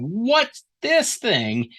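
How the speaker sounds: high, very slow, drawn-out amazement; stunned shock. Acted. Mark and John staring at the thing, stunned and slow. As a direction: stretch it out in horrified wonder. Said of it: "I would amplify that".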